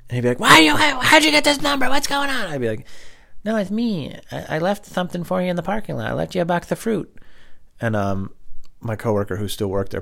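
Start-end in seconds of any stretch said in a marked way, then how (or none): 0.4-2.5 high, loud panicked voice; panicked old boss. Retold or imitated. Angry ex-boss answering phone. 3.4-7.1 higher, lightly sweet prank caller; a playful fake-innocent caller; put-on prank voice.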